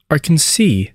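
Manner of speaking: Arkansas ending in "see"; wrong pronunciation. Shown as a demonstration. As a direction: say Arkansas as ar-kan-SEE, high then falling. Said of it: "It is not Arkansas".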